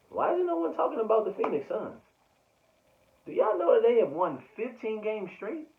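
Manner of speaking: high-pitched, loud mock disbelief; mock incredulous. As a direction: pitch the questions way up, playfully outraged. Mock-incredulous questions to the audience.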